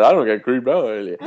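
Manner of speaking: mock yourself playing it tough; a hint of a guarded macho man. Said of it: "quickly said".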